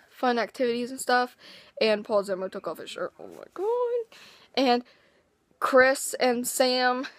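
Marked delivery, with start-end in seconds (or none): 3.2-4.2 fangirl squeal. Real, not acted. Fangirl reacting to shirtless singer.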